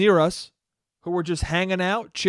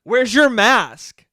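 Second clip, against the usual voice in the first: mock scolding; 4 semitones higher, 7 dB louder, much slower than usual. Mocking the girl's scolding.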